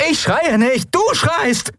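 very high indignant yell; quarrelling. Acted. Endriel denying she shouts at her pilot, indignant yelling. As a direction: yell back, pitch shooting up.

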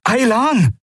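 very high, falling call. A narrator's reading. Kai calls for the missing Aylan, calling out. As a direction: call the missing friend's name urgently. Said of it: "rief Kai".

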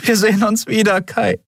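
high, emotional farewell; heartfelt parting cry; promising. A narrator's reading. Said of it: "versprach sie".

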